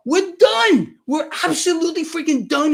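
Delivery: sudden loud shout of alarm; high, loud outburst at the peak of a rant; passionate shout. Real, not acted. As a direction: shout it out in full conviction. Ranting that we'll be destroyed.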